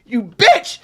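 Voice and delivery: lashing out while slapping the wall; angry cuss. Retold or imitated.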